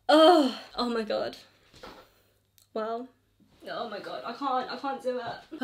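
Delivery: crying. Real, not acted. Reacting to the boy's email, real crying. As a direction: sob through it then trail off. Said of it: "I just had a little cry".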